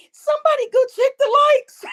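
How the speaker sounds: high, loud, laughing squeal; squeaking it out mid-laugh. Real, not acted. Asking chat to check the likes, laughing hard.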